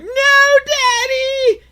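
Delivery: hammed-up high, loud child's wail; crying kid. Crying kid begging Daddy not to go back, mock tearful wail. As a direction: wail it like a bawling kid, exaggerated. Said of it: "the kid's crying like".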